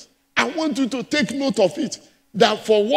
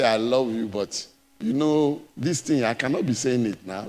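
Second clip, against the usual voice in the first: lower, mumbling excuse of a reluctant husband; mock husband; 10 semitones lower, 6 dB quieter than usual. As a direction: play the shy husband dodging it. Husband quoted in sermon.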